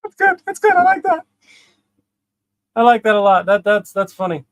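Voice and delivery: real high, loud amusement; loud, high-pitched approval; delighted reaction. Real, not acted. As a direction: burst out delighted at the joke. Delighted reaction, much higher.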